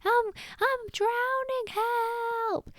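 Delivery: very high drowning wail; mock panicked shouting. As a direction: playact a frantic, drawn-out cry for help. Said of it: "flapping and splashing and shouting".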